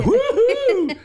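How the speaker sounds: high whooping cheer; mock whoop. Mock celebrating middle management.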